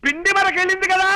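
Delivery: high-pitched calling to someone hard of hearing; strong, projected voice. Acted. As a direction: spell out the news at full projection. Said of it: "మామూలుగా చెప్పొచ్చు కదా".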